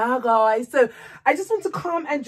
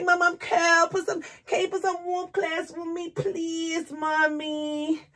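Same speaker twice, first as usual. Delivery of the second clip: voicing the little boy; 8 semitones higher, slower than usual.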